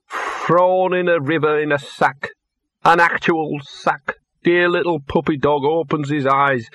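a dog feeling sorry for himself; tearful self-pity. A narrator's reading. Gaspode tells Laddie his sad start in life. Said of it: "a couple of huge tears coursed down his fur".

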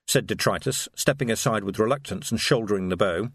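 ordinary delivery, nothing marked

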